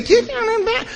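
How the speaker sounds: very high mimicking chirp; mock cheery greeter. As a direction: squeak it like an annoyingly happy greeter.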